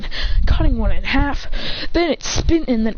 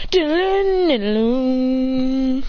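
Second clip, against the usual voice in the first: drawn-out humming "dun-dun"; slow, sustained sound effect; imitated power-up; 2 semitones higher, much slower than usual. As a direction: hum the energy charging.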